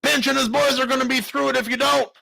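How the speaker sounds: high, loud urgent shout; shouting in alarm. A narrator's reading. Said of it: "Hardy shouted".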